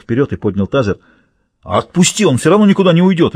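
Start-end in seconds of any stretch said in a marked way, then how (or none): 1.6-3.3 quick, high, urgent bark. A narrator's reading. Guard tells colleague the prisoner can't escape, barked. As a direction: rap out the objection fast and raised. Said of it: "рявкнул Бакстер".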